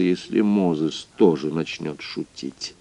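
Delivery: ordinary delivery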